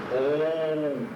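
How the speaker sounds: ordinary talk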